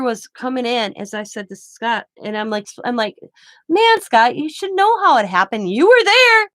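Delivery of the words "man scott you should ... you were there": high, loud, mock-exasperated ribbing; exasperated teasing rant. Teasing her husband at the clinic, loud and high-pitched. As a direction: ramp it up, higher and louder, like you can't believe him. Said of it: "I'm just going on and on".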